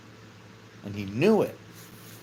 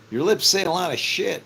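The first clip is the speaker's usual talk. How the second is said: louder and higher, with a laughing punch; emphatic outburst; 5 semitones higher, 5 dB louder than usual. Real, not acted. Preacher rebuking a cheering crowd, louder and higher. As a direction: bust out the line with a grin.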